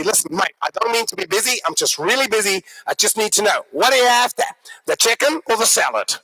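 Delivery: busy Aussie waiter; matter-of-fact broad Australian twang; broad Australian accent. Acted. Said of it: "very broad Australian accent".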